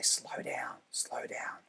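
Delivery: hushed, almost whispered; soft whispered slow down; low calm instruction. Shown as a demonstration. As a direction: breathe the words out softly to calm the learner. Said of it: "that lower tone".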